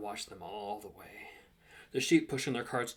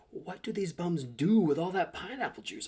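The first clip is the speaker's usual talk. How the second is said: baffled exasperation; 7 semitones higher, 5 dB louder than usual. A narrator's reading. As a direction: wonder aloud in mock bewilderment. Narrator's exasperated question to himself.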